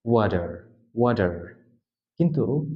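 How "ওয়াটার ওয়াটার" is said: ওয়াটার as "wa-derr", final R sounded; clear American R. Shown as a demonstration. Teacher showing Americans keep the final R, sounded R. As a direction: curl a clear R at the end of ওয়াটার. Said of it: "শব্দের শেষে যে আরটা আছে".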